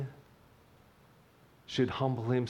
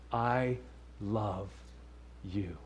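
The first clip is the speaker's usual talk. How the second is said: soft and quiet, fading lower; gentle hushed I love you; tender loving voice; 3 semitones lower, 7 dB quieter, much slower than usual. Retold or imitated. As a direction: say it like a father to his child. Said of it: "hear that voice".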